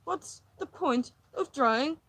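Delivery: loud, high, slow indignant cry; exasperated outburst. Acted. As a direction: burst out in frustration. Exasperated outburst about dishes.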